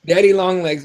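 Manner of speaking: ordinary talk, nothing marked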